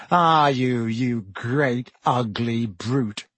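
slow, sneering insult; drawled. A narrator's reading. Malfoy insulting the hippogriff, drawling. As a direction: stretch each word with disdain. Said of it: "Malfoy drawled loud enough".

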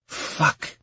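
soft, unvoiced sigh; sighed curse. Camellia hears the bad news from Ion, sighing. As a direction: let the word out on a sigh. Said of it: "She sighed".

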